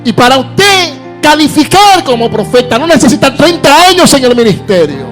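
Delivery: loud, high fiery preaching; preaching at full force. Acted.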